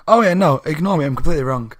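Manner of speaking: ordinary delivery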